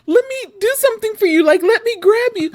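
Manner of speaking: very high, breaking, desperate pleading; crying out to save the squirrel; tearful plea. Retold or imitated. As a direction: beg through tears, voice up high. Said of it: "I'm getting emotional thinking about it".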